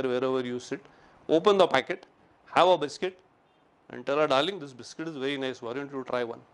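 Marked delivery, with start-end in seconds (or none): 4.3-6.4 sweet offer. Pretend. Sweetly offering wife a biscuit.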